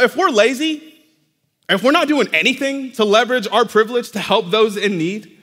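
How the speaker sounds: ordinary talk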